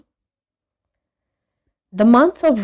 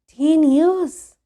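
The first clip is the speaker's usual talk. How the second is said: higher, louder disbelief; amazed boy; 8 semitones higher, 5 dB louder than usual. A narrator's reading. A boy stunned by the old man's story, higher and louder. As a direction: gasp it out in wonder.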